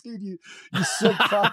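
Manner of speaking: retold mock outrage through a laugh; amused outrage. Retold reaction to medic's morphine joke.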